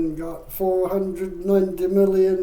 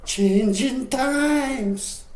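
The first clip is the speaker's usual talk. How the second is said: mock politician; 6 semitones higher, much slower than usual. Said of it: "I saw one of your interviews".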